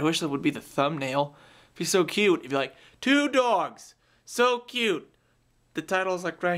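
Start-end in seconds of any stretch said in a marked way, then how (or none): 1.7-5.1 cutesy. Real, not acted. Gushing over dogs.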